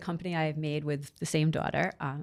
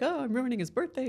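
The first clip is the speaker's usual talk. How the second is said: higher, mock tearful wail; imitating crying daughter; 8 semitones higher, 3 dB quieter than usual. Mom imitating her daughter crying over ruined muffins, mock tearful. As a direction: play the upset kid, half-hammed. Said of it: "she's hysterical, crying".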